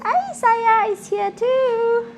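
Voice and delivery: high, slow cooing to the dogs; pet talk voice. Real, not acted. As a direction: coo sweetly at a puppy.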